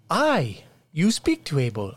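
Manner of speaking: high, eager peasant greeting; peasant villager. Acted. GM greets players as humble peasant, high and eager. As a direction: welcome the lords like a fawning villager.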